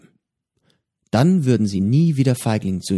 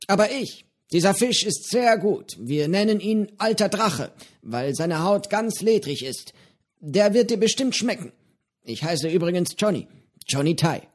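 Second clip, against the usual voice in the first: höhere, lockere Piratenstimme, freundlich plaudernd; Johnny, der alte Pirat, prahlt gemütlich mit seinem Fisch; gutmütiger Pirat Johnny; 8 semitones higher than usual. A narrator's reading. Johnny als Figur gesprochen. (höhere Stimme eines gutmütigen alten Piraten)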